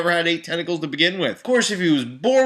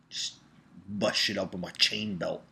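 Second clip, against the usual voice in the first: ordinary delivery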